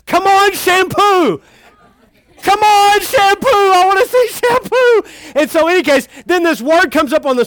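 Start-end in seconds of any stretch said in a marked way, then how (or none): 0.0-5.0 put-on high, loud little-kid chant; excited toddler. Father imitating his toddler son cheering at the whale, high and eager. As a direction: cheer on the whale like an eager two-year-old. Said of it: "he kept saying shampoo".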